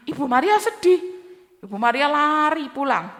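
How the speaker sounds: ordinary delivery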